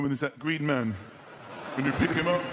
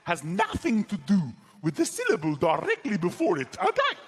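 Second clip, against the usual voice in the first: loud, high South African accent demo; exaggerated, jumpy South African syllables; 5 semitones higher, 4 dB louder than usual. Shown as a demonstration. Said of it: "talk to yourself in a South African accent".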